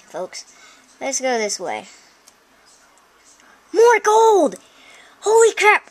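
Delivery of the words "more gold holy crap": high, loud thrilled cry; excited discovery. Real, not acted. Player spots more gold in the mine, thrilled shout. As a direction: shout it high, astonished at the find.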